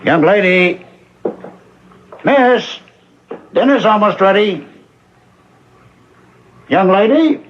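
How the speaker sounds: calling upstairs. Acted. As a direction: call her down to dinner, voice lifted.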